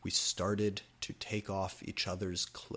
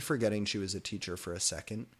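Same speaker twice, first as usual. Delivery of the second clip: ordinary delivery, nothing marked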